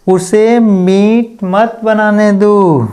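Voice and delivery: slow, careful Hindi read-out; slow demo. Shown as a demonstration. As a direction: spell it out for the class. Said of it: "okay".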